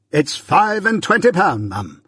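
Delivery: high-pitched, grand echo; pompous echo. A narrator's reading. Pumblechook echoes Joe pompously.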